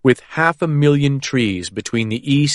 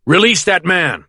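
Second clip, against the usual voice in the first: yelled command; 7 semitones higher, 4 dB louder than usual. A narrator's reading. Agent continuing the yell.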